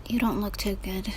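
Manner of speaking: ordinary talk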